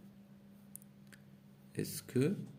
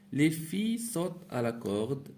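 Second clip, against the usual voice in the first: reading aloud; 3 semitones higher than usual. Shown as a demonstration. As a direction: read out to the class, a bit raised. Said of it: "teacher reads the exercise sentence". (lecture claire à voix haute)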